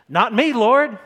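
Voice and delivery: loud, high, cocky boast; brash loud bragging disciple; bravado. Retold or imitated. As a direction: puff up like Peter swearing loyalty. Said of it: "He's all bravado".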